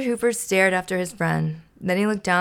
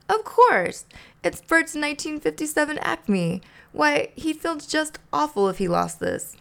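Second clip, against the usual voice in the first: high Ernie voice; 8 semitones higher than usual. A narrator's reading. Ernie finds Bert's paperclip, high and excited. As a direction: squeak out the discovery as Ernie.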